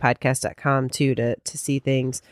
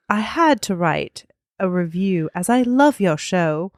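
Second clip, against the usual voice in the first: slow, put-on British accent; 2 semitones higher than usual. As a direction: read it slowly as an imagined British listener. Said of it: "you have to do it in their voice".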